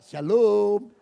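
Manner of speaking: drawn-out friendly shalom; sweet church greeting. Retold or imitated. Rude driver greets the pastor, sweet drawn-out shalom.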